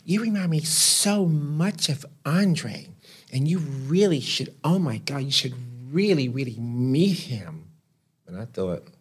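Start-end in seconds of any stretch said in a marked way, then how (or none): 0.0-7.7 high, drawn-out gushing imitation; put-on high voice, effusively insistent; Pat Cleveland. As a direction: stretch the words, way up in pitch. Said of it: "you know, Pat Cleveland".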